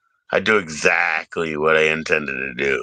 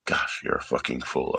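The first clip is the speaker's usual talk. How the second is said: usual speaking voice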